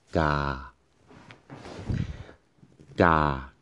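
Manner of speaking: ga held level and flat; ga on one steady pitch. Shown as a demonstration. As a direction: keep ga dead level all the way. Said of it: "See, it goes flat all the way".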